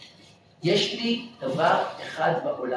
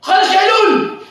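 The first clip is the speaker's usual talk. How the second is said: high, loud, slow command; slow loud high "חודש אלול!"; commanding shout; 11 dB louder, slower than usual. Retold or imitated. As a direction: call the dog back with the code word. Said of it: "הוא נתן לו צעקה".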